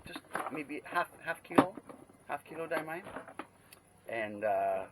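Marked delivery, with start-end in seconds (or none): 0.0-3.0 eager retold request. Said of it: "I said".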